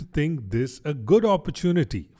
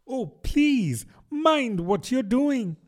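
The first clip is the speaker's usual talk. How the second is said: terrified cry; 11 semitones higher, slower than usual. Acted. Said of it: "cried Alice ... agony of terror".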